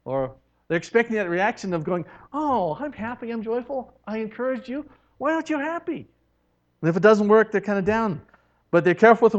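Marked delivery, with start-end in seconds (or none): 2.3-6.1 higher, chirpy, puzzled at the end; beam, then wonder why they don't beam back; cheery bewildered. Acted. Merciful person puzzled that encouragement didn't cheer, high and chirpy.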